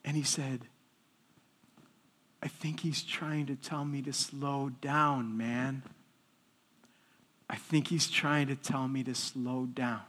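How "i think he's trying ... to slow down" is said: soft, low, subdued voice of a man moved to tears; retold tearful admission. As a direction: quietly act out a humbled inmate. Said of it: "He started crying".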